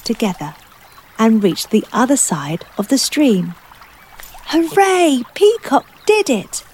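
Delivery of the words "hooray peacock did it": excited cheer. A narrator's reading. Cheering at peacock's success.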